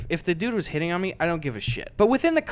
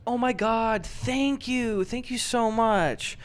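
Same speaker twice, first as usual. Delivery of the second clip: higher, slow gushing thanks; gushing gratitude; 6 semitones higher, 4 dB quieter, slower than usual. Retold or imitated. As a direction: lay on the gratitude. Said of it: "right away he goes".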